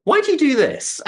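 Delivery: high, loud puzzled question; a mentor's amused challenge; quizzical critique. Retold or imitated. [name] questioning his harmonies, high puzzled challenge.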